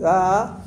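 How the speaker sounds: a long, loud open-mouthed "Ah"; loud ENT "ah". Shown as a demonstration. Teacher shows students the patient's "Ah", loud and open. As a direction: open wide and sing out "Ah" for the throat exam.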